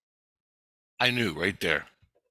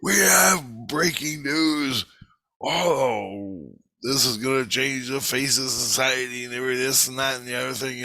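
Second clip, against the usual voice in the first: put-on old newscaster voice; 4 semitones higher than usual. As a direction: play an old TV newsman breaking big news. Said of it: "the air and he goes".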